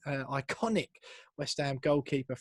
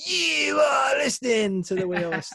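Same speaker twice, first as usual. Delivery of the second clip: trademark intro call; 11 semitones higher, 7 dB louder than usual. Shown as a demonstration. Host mocks his own trademark podcast intro, booming. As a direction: stretch it out like a big stadium announcer. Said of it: "my Radio Four intro ... better than my".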